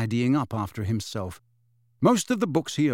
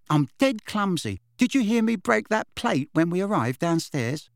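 higher, lively witch voice; chatty witch; 4 semitones higher than usual. Acted. Witch admitting her clumsiness to Harry, chatty. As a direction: play a breezy, self-mocking young witch.

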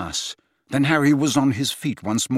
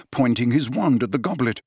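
usual speaking voice

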